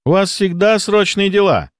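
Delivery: high, insinuating jab; pointedly sarcastic superior. A narrator's reading. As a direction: lift the voice in mock understanding. Superior insinuates about doctor's affairs.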